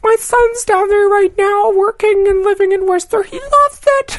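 very high proud-mom mock voice; woman quoted. Seatmate bragging about her son, high mock voice. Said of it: "She's like".

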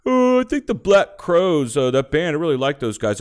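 high, loud mock enthusiasm; gushing fan imitation. Acts out someone gushing about band.

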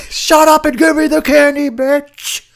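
high, loud put-on yell; Darnell shouting. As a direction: bark it like a rude trick-or-treater. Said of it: "go as that guy for Halloween".

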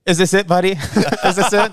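high, loud teasing through laughter; dad laughing. Retold or imitated. Dad teasing his freezing son, laughing, high and loud. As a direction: laugh out the question, mocking the kid. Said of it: "he goes".

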